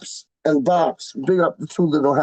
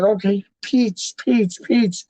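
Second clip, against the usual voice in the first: imitating Wally; 4 semitones higher, slower than usual. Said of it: "And he's like".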